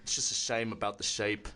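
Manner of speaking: put-on low British baking-judge accent; deep British verdict on a bake; Paul Hollywood. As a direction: mimic a British TV bake-off judge, pitched well down. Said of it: "doing the Paul Hollywood".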